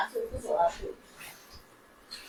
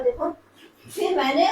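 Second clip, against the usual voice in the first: ordinary delivery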